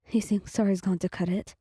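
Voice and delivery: ordinary delivery, nothing marked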